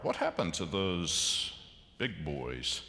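normal talking voice

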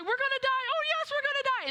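imitating panicky friends; mock panic. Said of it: "And they're like".

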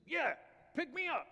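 high, squeaky little frog voice; high-pitched cartoon frog; talking frog. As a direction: call out as a tiny frog.

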